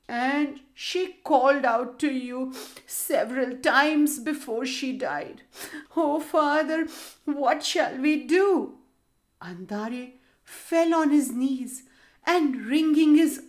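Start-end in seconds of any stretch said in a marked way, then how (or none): 0.1-8.8 put-on sobbing boy. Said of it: "sobbed the lad".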